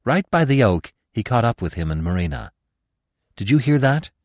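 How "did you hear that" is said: higher-pitched, alarmed question; urgent cry. A narrator's reading. Bambi catching up with Gobo, crying out urgently. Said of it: "he cried to him".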